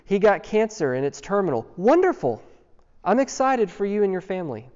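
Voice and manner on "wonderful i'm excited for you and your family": higher-pitched, put-on delight; mock congratulations. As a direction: congratulate them as if it were great news. Cheerful congratulation to bad news, hypothetical.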